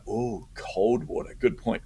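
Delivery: ordinary delivery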